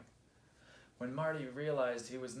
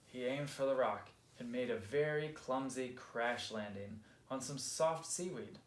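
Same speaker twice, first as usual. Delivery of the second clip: usual speaking voice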